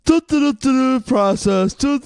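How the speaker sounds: mock a teammate droning the coach's process; mindless player drone. Mocking a brainwashed player in the locker room, high droning da-da. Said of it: "you're just like".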